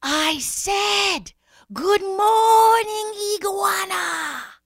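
high, drawn-out shout; Snake repeating himself. A narrator's reading. Snake greets unhearing Iguana again, shouting insistently. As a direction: holler every word long and high. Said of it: "Snake said".

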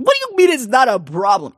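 loud, high disbelief; can't believe what she said; incredulous father. Acted. Father shocked by his daughter's calm, loud and high.

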